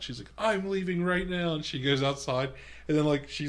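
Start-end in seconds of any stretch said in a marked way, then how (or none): 0.4-1.5 higher, slow mock panic; imitated freak-out. Retelling Jennifer Aniston storming outside, mock freak-out. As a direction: mimic someone freaking out, pitched up. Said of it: "like, freaking out?".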